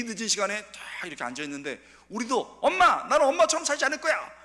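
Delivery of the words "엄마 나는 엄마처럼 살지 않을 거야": much higher, loud cry of defiance; defiant teen shout. Retold or imitated. Daughter shouting at her mother, loud and defiant. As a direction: fling it at mom like a rebellious daughter.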